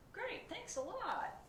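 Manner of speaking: high, slow sarcastic thanks; sarcastic pigeon. Acted. Pigeon sulks at the driver, sarcastic. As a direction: drip with mock gratitude.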